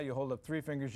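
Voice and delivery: ordinary delivery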